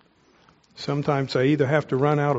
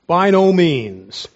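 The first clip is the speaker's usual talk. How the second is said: slow, loud, every word hammered; By no means, said slow and loud with weight on every word; emphatic; 3 semitones higher, 7 dB louder, much slower than usual. Real, not acted. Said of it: "I can't say that in a way that's emphatic enough".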